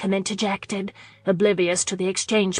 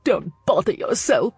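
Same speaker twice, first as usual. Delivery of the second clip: acid mutter. A narrator's reading. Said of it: "she muttered acidly".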